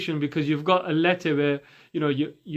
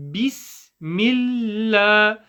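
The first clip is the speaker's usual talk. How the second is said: slow, drawn-out syllables; Quranic recitation; 5 semitones higher, 4 dB louder, much slower than usual. Shown as a demonstration. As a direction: recite with long held vowels. Repeats the recited model.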